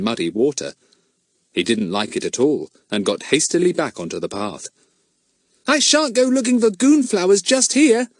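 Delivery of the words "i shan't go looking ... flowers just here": put-on high, far boy's voice, a bit rueful; young boy backing off from the mud; high little boy Pip. A narrator's reading. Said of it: "he said".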